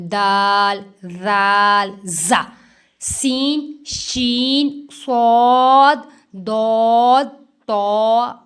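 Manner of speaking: each letter loud and drawn out; reciting the ikhfa letters. Shown as a demonstration. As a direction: call out the letters slowly. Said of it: "मैंने अभी बताए थे". (इख्फा के हरूफ ज़ोर से, खींचकर)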